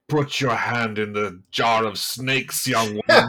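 slow, grand and booming; loud, slow theatrical pronouncement; Viola Davis. Retold or imitated. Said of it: "Viola Davis is like".

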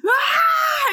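high, loud scream re-enacted; retold scream. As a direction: shriek it like waking from a nightmare. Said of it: "I was screaming and being like".

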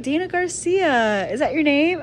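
lightly voiced stiff caller; mock formal name check. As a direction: read the name out like attendance. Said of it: "he was like".

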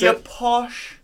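high, loud posh British word; mock posh accent. Friend mocks the showy outfit, mock posh British accent.

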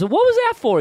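high, loud, incredulous squawk; shocked disbelief. Retold or imitated. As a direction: yelp it out like you've just been had. Dad realizes son tricked him.

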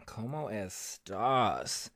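very slow, low, soft drawn-out catchphrase; Wendy Williams. Acted. Host greeting viewers with a catchphrase, slow low impression. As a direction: stretch it out, low and hushed. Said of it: "say it like Wendy Williams".

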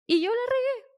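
high, loud mock self-reproach; exasperated at yourself, retold with a pitch jump; retold self-scolding. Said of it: "estaba súper enojada conmigo porque dije".

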